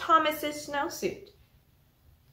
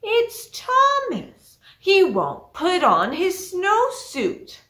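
high, loud and slow complaint; exasperated teacher; 6 semitones higher, 6 dB louder, much slower than usual. Acted. Teacher complaining to the principal about Thomas, high and whiny. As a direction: whine it out to the principal. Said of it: "And the teacher said".

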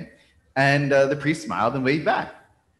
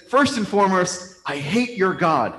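higher, emphatic, slowing on the final words; acted hateful declaration; 6 semitones higher, 3 dB louder than usual. Man tells a priest he hates his God, hammed-up hostility.

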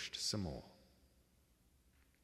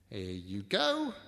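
sly crook voice; 7 dB louder, slower than usual. Acted. Crook voice through the door.

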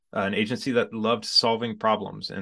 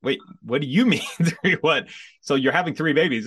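incredulous laugh; 6 semitones higher, 5 dB louder than usual. Retold or imitated. Retelling shock at triplets news.

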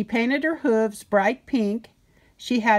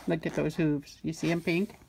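soft aside; 4 semitones lower, 7 dB quieter than usual. Real, not acted. Reader softly shows the child the picture. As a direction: point it out softly.

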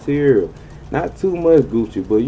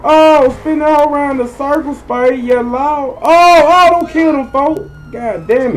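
much higher, loud frantic shouting; frantic yelling; 6 dB louder than usual. Real, not acted. Spinning the car round the circle in the game, frantic high yelling. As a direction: scream through the chaos of the drive.

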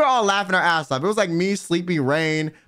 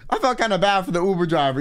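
ordinary talk, nothing marked

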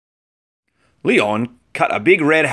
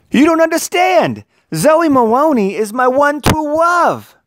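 put-on pleading boy; 3 dB louder than usual. A narrator's reading. Leon pleads.

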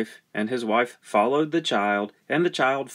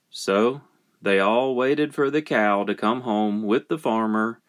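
ordinary delivery, nothing marked

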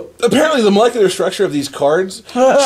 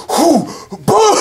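excited sound effects; 13 semitones higher, 3 dB louder than usual. Retold or imitated. Retelling an anime card duel.

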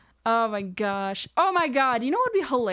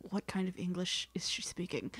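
mock neighbour whisper; 10 dB quieter than usual. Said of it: "They're just like".

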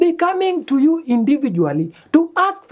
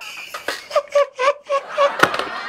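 high airy burst of laughter; 3 dB quieter than usual. Real, not acted. Laughs at mama mboga.

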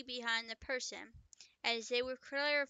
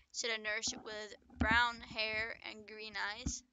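ordinary delivery

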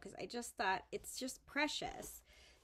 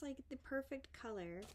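ordinary talk

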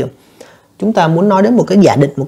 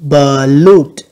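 Pollute loudly, with LUTE punched; loud teacher's model of Pollute, stressing LUTE; stress on second syllable; 5 dB louder than usual. Shown as a demonstration. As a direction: hit the last syllable of Pollute hard. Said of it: "còn lại là âm thứ hai hết".

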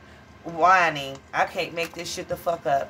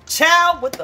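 shocked exclamation; 10 semitones higher, 9 dB louder, slower than usual. Real, not acted. Reader reacting to a shocking tarot card, loud, high exclamation. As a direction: burst out in disbelief.

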